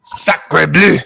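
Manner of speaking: exaggerated Quebecois exclamations; joking French oaths to sign off; mock French accent.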